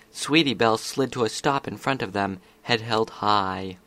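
plain reading voice

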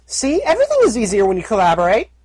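high, chirpy filly voice; young filly voice. Acted. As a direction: talk like a cheerful little filly. Filly character voice.

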